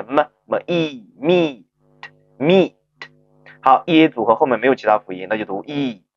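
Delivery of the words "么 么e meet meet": slow blend of 么 and E into "meet"; sound-by-sound blending. Shown as a demonstration. Teacher showing learners how to sound out meet, slow blending. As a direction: draw out 么, E, then meet. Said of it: "前面的辅音是么".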